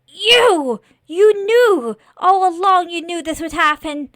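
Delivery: high, very loud outburst; furious accusation. Acted. Scarecrow accuses the lord of knowing all along, furious. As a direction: hurl it loudly at the betrayer.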